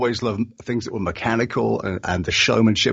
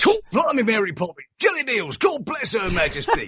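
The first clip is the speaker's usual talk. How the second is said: much higher, hammed-up posh British accent; exaggerated British accent; 10 semitones higher than usual. Shown as a demonstration. Asked for a British accent, hammed-up British voice. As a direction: overdo the British accent for laughs. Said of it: "you do British accents".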